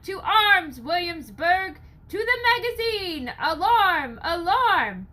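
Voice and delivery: high, loud rallying shout; call to arms. Acted. Crier calls Williamsburg to arms, high loud shout. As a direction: rouse the whole town to arms.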